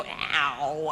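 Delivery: low imitation of a Cockney "Ow"; Cockney vowel imitation. Learner describing the accent to her teacher, imitated Cockney. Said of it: "the one where they go".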